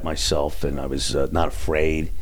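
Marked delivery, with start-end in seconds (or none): none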